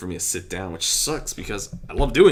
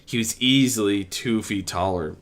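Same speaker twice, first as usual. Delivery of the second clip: ordinary delivery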